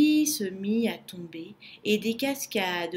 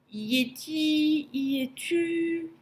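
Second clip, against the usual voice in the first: high, slow, timid call; small frightened voice, drawn out; trembling murmur; 6 semitones higher, much slower than usual. A narrator's reading. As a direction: ask it fearfully, stretching the words, without shouting. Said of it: "murmura Lou tout tremblant".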